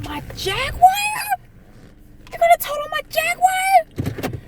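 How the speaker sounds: very high, slow, wailing outrage; outraged disbelief. Retold or imitated. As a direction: cry out at losing your car. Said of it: "I said".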